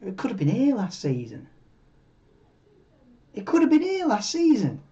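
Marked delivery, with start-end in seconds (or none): 3.3-4.8 much higher swoop, falling at the end; higher, emphatic repeat. Real, not acted. Streamer recalling Ferrari's last race win, emphatic high-pitched repeat. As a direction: lift "been here" high, then drop away.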